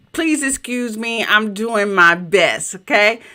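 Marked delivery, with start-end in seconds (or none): none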